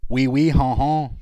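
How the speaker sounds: loud, high hammed-up French-Canadian accent; mock Quebecois French. Teasing chat about how Quebecois talk, mock Quebecois accent. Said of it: "compared to the people in Quebecois".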